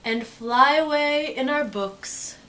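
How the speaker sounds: slow, cozy storybook voice; storybook read. Acted. Teacher reading the bedtime page to kids, slow and cozy. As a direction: ease them toward bedtime.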